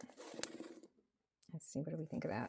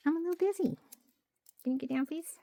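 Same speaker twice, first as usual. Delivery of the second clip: high, louder pet scolding; scolding the cat; 10 semitones higher, 4 dB louder, faster than usual. Real, not acted. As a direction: tell the cat off playfully, up high. Cat interrupting again.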